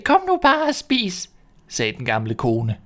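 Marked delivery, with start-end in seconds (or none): 0.0-1.3 put-on old woman voice. Old woman distracts the robbers.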